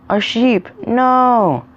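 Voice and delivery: gasp, then high, rising-loud horror; shocked dismay. A narrator's reading. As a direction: cry out in acted shock, pitch way up. Villagers discover the dead sheep.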